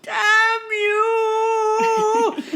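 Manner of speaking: high strained mock shout; mock curse. Hosts voicing the cardinal's curse, high mock shout. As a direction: shake a fist and yell it. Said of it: "He's like".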